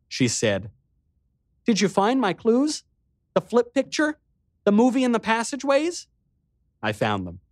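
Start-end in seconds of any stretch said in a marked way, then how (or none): 1.6-6.1 high, eager little sister's voice; a girl perking up, playful again; mischievous energy. A narrator's reading. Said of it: "a sudden hint of her old mischievous energy".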